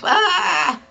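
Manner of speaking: high-pitched scream at ordinary volume; mock scream. Shown as a demonstration. Singer warning against screaming when upset, mock scream. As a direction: let out a demonstration scream, held back. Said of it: "don't scream on the top of your lungs".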